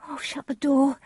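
high, distressed cry; high-pitched plea; Juliet. A narrator's reading. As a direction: cry it out in despair. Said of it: "she cried".

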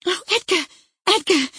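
high, loud, overwrought cries; panting excitement. A narrator's reading. Wife flies upstairs to her husband with news, panting excitement. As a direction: cry his name, wild with breathless excitement. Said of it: "she panted".

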